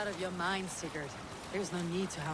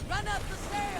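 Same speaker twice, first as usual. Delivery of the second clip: high call across the way; raised call to someone ahead. Acted. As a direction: shout the order up the stairs. Calling out an order.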